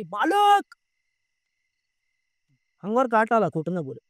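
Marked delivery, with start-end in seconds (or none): 0.0-0.7 high, loud startled cry of master; loud call. Retold or imitated. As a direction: call out to the master, much higher and louder. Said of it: "त्याने असला मोठ्याने आवाज दिला". (उंच, मोठ्याने मालकांना हाक)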